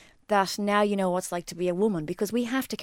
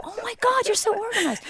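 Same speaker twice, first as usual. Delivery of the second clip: much higher, gushing fake admiration; mock awed gushing; 13 semitones higher than usual. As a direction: squeal it like a dazzled fan of their kit. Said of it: "and you go".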